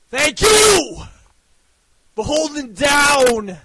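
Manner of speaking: breathless yelling, gasping for air; shouting while winded; out of breath. Real, not acted. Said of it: "I'm fucking out of breath".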